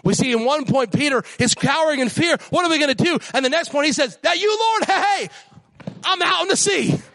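high, ringing preacher's cry; preaching at full force. Real, not acted. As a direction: lift the church up with every line.

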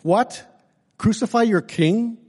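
high, rising disbelief; incredulous question. A narrator's reading. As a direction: ask it as if you can't believe it. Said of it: "Pilate asked".

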